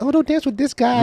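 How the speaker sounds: high mock gossiping girl; catty warning, high and mocking. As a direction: pitch up to play the girls warning each other. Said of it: "They talk to, like".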